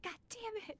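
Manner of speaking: high-pitched mock scream at a game; high, playful outburst of frustration; mock gamer scream. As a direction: imitate yourself yelling at a video game. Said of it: "screaming at a game and being like".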